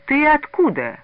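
high, loud challenge; sudden indignant demand; angry outburst. A narrator's reading. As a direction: burst out at the stranger. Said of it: "вдруг гневно вырвался из толпы Мазин".

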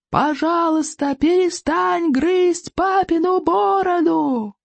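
high, slow pleading shout; yelling at full voice. Acted. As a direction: beg at the top of your lungs. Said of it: "Икинг заорал во весь голос".

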